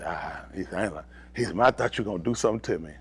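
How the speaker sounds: quiet low rushed line; low mutter at quick pace; low, quick quoted voice. Retold or imitated. Frightened man retold, low voice.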